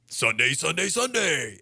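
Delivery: booming hype announcer, stretched; monster truck ad. Acted. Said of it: "start doing monster truck commercials".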